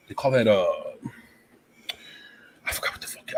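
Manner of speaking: under-breath whisper to self; whispered aside. Real, not acted. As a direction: whisper it to yourself. Muttered aside while searching the name.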